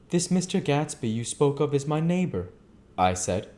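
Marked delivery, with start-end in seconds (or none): 0.1-2.5 somewhat higher gentleman's line; Nick speaking. Acted. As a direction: say it plainly and politely. Nick's line, retake.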